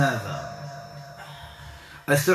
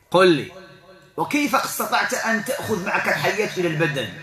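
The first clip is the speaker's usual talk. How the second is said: ordinary talk, nothing marked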